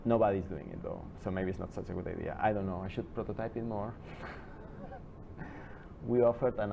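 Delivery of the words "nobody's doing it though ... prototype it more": ordinary talk, nothing marked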